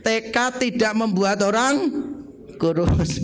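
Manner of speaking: slow, measured phrasing, holding off before "kurus"; lead a repeat-along. Shown as a demonstration. Preacher has those not yet thin say it with him, slow and deliberate. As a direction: say it slowly for the crowd to repeat. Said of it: "sama-sama yang belum kurus ngomong dengan saya".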